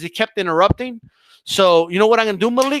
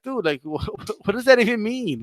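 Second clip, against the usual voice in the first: ordinary talk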